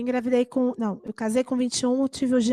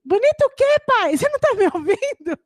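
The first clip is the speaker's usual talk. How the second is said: high, loud reproach; indignant daughter; 11 semitones higher, 6 dB louder than usual. Retold or imitated. Daughter catches father not listening, high loud reproach. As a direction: call dad out for not listening.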